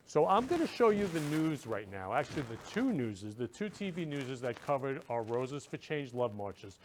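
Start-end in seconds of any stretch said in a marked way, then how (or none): none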